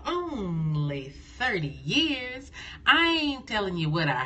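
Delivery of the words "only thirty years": slow, soft mock modesty; proud understatement. Real, not acted. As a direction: say it slowly, coyly downplaying.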